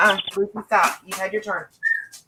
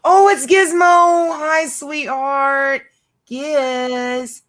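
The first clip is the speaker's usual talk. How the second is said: greeting a pet bird, slow high coo; pet talk; 7 semitones higher, 5 dB louder, much slower than usual. Real, not acted. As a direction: coo over the bird, drawn out.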